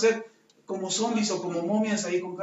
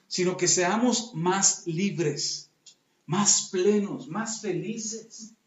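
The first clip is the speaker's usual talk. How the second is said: slow emphatic list; 2 semitones lower, much slower than usual. Real, not acted.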